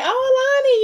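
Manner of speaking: very high excited oh; gushing greeting. Retold or imitated. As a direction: squeal the oh up high. Friends greeting her warmly.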